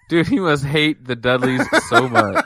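amused outburst broken up by laughs; laughing. Real, not acted. Co-host reacting to a wrestler's bad luck, laughing. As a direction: talk through a burst of laughter.

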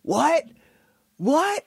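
high, punched mock yell; Stone Cold yelling. Wrestler yells in the boss's face, high mock yell. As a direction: imitate an in-your-face taunt. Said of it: "he starts yelling in his face".

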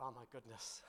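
hushed and low, breath almost gone; barely audible gasp of dread. Retold or imitated. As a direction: mutter it under the breath in shock. Retold terrified inner thought.

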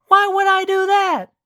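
high, slow woman's voice; high female voice. A narrator's reading. Elena's voice.

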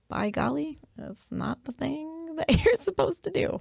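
high voice breaking into a laugh; playful, high-pitched laughing finish; jokey emphasis. Real, not acted. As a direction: rise high and laugh through it. Joking emphatic close, laughing.

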